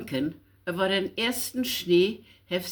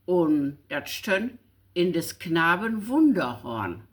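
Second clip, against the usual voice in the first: ordinary delivery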